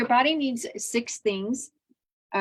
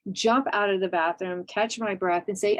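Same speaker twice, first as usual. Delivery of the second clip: ordinary talk, nothing marked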